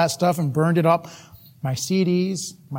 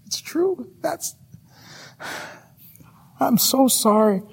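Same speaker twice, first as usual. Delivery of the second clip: tearful confession; 5 semitones higher, slower than usual. Acted. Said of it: "she began to cry".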